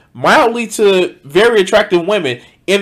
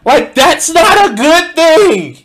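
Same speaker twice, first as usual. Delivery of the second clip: high, loud, slow disbelief; exasperated emphasis; 10 semitones higher, 4 dB louder, slower than usual. Real, not acted. Reacting to the narrator on violence, loud disbelief. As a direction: hammer it home, incredulous.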